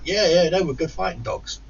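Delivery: high put-on reply; mocking dog buyer.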